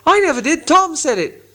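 very high, loud troll denial; protesting troll. Acted. William blaming Tom, high shrieking troll denial. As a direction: deny it hotly. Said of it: "said Bert".

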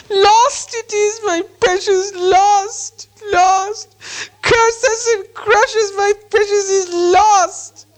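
slow, very high wail of loss; keen it like a wretched creature, stretching the words; crying Gollum. A narrator's reading. Gollum mourns his lost precious, crying and cursing. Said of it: "Bilbo heard him crying".

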